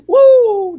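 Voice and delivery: high loud whoop of glee; gleeful woo mid-laugh. Real, not acted. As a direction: let out a delighted woo.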